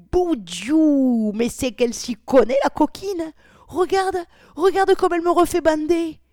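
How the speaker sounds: high, loud, delighted outburst; excited exclamation. A narrator's reading. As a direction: crow it out loud and high, amazed. Narrator voices a character's excited exclamation.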